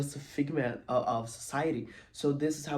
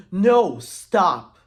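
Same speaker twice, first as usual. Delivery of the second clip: loud, higher-pitched desperate halt; urgent shout; 6 semitones higher, 16 dB louder than usual. Retold or imitated. Mother stops hunters shooting wolves, urgent acted shout. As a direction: cry out to stop them, full volume.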